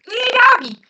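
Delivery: high, loud cheerful call in a Southern twang; imitating Leslie. Vlogger mimics Leslie naming her ruler, high loud playful call. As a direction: sing it out high and loud like greeting a pet. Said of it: "she's got pet names for her rulers".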